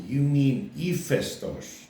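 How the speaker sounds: Hephaestus said the Greek way, ee-FES-tos, slow; Greek pronunciation. Retold or imitated. Greek local correcting a foreigner's pronunciation, slow Greek ee-FES-tos. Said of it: "They go".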